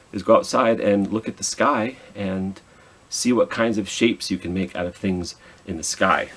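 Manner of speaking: plain reading voice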